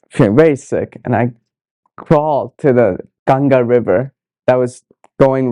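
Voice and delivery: ordinary delivery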